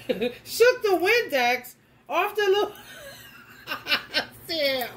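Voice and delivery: slow words through laughter; can't stop laughing as you tell it; laughing while talking. Real, not acted. Describing the beetle shaking off Windex, laughing through it.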